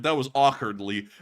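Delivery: usual speaking voice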